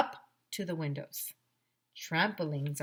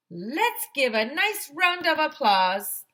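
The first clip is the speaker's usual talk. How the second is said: higher, louder, slow announcer voice; principal announcing; 8 semitones higher, 7 dB louder, slower than usual. Acted. Principal introducing firefighter to students, announcer style. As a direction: introduce her like a host. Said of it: "said Mister Klutz".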